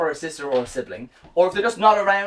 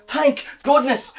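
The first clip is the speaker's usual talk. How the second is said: usual speaking voice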